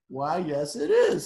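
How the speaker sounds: loud, puffed-up yes; proud dragon. Acted. Dragon flattered by the princess, booming proudly. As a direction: swell up with pride. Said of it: "said the dragon".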